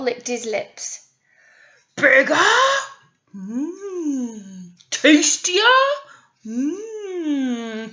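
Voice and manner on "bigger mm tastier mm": troll crying out. A narrator's reading. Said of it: "he cried".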